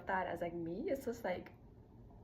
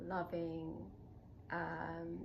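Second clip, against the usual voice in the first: ordinary delivery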